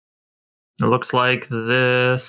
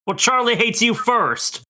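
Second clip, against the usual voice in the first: higher, petty character voice; childish comeback; 9 semitones higher than usual. Acted. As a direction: bicker like a kid. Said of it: "Voice acting".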